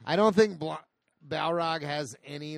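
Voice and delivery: normal talking voice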